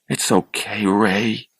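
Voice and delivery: gently calm him down; said softly. A narrator's reading. Charlie soothing his frightened brother, softly and quietly. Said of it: "Charlie said softly".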